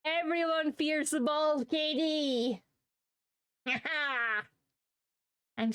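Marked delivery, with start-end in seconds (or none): 0.1-2.6 slow, higher, hammed-up villain boast; mock villain. Streamer boasting as bald Katie, slow mock villain. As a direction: declare it like a cartoon overlord. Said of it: "There we go".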